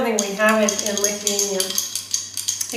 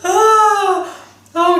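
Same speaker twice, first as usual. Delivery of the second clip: laughing; 9 semitones higher, 7 dB louder than usual. Real, not acted. Laughing reaction.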